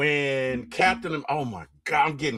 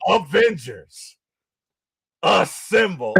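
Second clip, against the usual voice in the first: rallying battle cry; 4 semitones higher, 3 dB louder, slower than usual. Retold or imitated. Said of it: "And then it's like".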